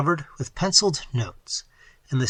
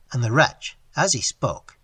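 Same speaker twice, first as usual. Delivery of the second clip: ordinary delivery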